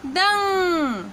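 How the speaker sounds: दंग said clearly with the nasal ng; teaching demo. Shown as a demonstration. Teacher showing students how to say दंग. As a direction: model दंग for learners. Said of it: "उच्चारण हो जाएगा".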